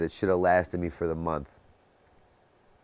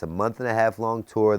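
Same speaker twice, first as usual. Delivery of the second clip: ordinary talk, nothing marked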